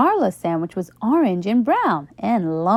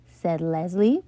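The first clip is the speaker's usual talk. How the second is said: usual speaking voice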